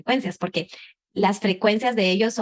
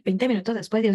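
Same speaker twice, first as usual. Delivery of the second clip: ordinary talk, nothing marked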